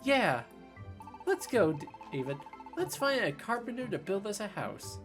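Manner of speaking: lightly voiced upbeat companion; cheerful game character. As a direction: read the partner's lines brightly. Reads game partner's lines.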